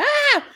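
short high yelp of fright, imitated; a sudden high "Ah!" like grandma startled; high mock scream. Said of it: "She was just like".

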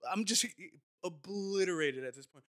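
usual speaking voice

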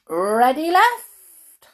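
high, loud little boot voice; squeaky boot voice. Acted. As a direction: play a tiny talking boot.

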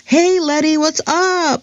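high, bright American call of a nickname; English-accented Letty. Retold or imitated. Classmates calling out her nickname, in a breezy English accent. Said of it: "okay, with the English accent".

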